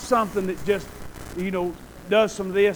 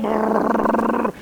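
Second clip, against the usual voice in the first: lips buzzing like a car engine; engine rumble. Retold or imitated. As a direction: rumble like an old car rolling. Car rolling sound.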